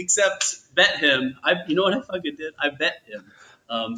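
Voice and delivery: usual speaking voice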